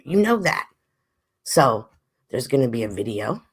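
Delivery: ordinary talk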